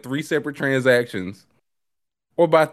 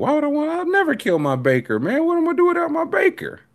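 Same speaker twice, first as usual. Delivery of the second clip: mock high outrage; faster than usual. Mock outrage at killing baker.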